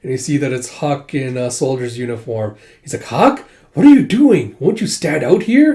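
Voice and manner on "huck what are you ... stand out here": high, loud, drawn-out surprise; acted incredulous outburst; startled exclamation. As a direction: exclaim at finding a friend here. Acted surprise at disguised friend.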